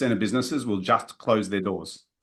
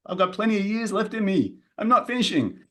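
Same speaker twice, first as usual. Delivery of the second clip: mock indignant client; 9 semitones higher than usual. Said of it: "some of you are thinking".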